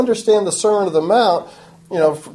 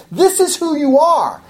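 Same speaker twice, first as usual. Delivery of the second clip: harsh, unsoftened; 9 semitones higher than usual. Retold or imitated. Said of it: "I'm gonna say it with that type of harshness".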